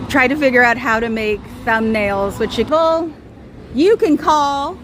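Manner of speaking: ordinary talk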